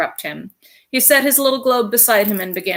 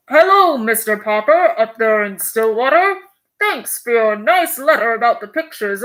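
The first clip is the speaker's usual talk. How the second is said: louder, announcer-like radio broadcast; radio voice from afar; 3 semitones higher, 4 dB louder than usual. Acted. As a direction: call out over a crackling radio from the South Pole. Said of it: "a faint voice floated out".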